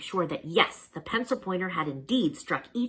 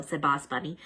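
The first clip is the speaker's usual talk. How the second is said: usual speaking voice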